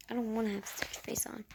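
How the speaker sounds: quick, hushed whisper; whispered aside. Real, not acted. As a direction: whisper it fast under your breath. Hushed aside after retold quote.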